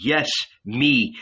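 ordinary delivery, nothing marked

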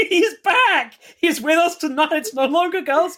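high, laughing exclamation. Real, not acted. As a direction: laugh as you announce it. Laughing excitedly.

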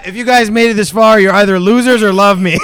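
slurring his words; tipsy, loose slurred speech. Real, not acted. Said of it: "we got [name] slurring his words here".

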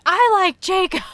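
high, shouted retort; loud insistence. Retold or imitated. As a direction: yell it back in a bus argument. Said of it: "and I was like".

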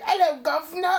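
very high, hammed-up British accent; silly British accent, drawn out for comedy; mock British accent. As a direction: caricature a British lady in a squeaky-high voice. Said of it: "later she sounds British".

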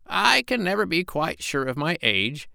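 put-on very high old parrot voice; the parrot musing to the doctor, very high voice; parrot Polynesia. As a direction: play the parrot way up in the head.

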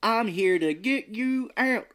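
high, loud, slow hammed-up Southern drawl; mock Southern accent. Mocking Keanu Reeves' movie role, hammed-up Southern accent. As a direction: overdo a Southern lawyer voice, Southern accent. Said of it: "he talks like this too".

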